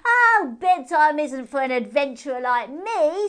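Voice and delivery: high, loud teasing hare voice, slow on ploppy pants; cheeky moon hare. Acted. Moon hare teases P J with a high cheeky voice. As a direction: tease her with each silly name.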